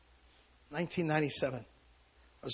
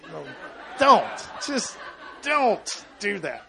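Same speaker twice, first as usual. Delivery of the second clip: don't, just don't, higher, louder, slow; loud refusal; 5 semitones higher, 4 dB louder, slower than usual. Real, not acted. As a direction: cut them off and push the comfort away. Refusing comfort, raising his voice.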